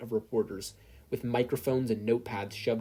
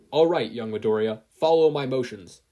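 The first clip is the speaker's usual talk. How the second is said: louder hero coach; booming instructor; 8 dB louder than usual. A narrator's reading. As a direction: instruct loudly like a proud mentor. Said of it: "Toshinori instructed".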